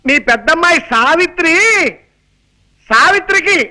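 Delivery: high-pitched calling out, phrase by phrase; strong, exaggerated voice. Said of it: "మామూలుగా చెప్పొచ్చు కదా".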